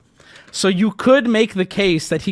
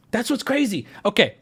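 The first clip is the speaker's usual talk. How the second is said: ordinary talk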